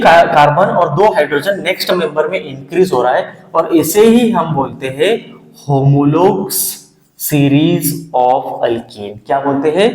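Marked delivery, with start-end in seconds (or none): 5.7-9.2 very slow dictation. Shown as a demonstration. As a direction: stretch series of alkene out very slowly. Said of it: "dictating the new term". (नया शब्द बहुत धीरे खींचकर)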